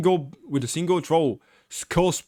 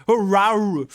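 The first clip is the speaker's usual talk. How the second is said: bellow; 6 semitones higher, 6 dB louder than usual. Acted. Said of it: "bellows with anger".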